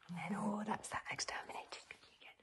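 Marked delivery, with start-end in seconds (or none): none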